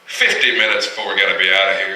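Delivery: ordinary talk, nothing marked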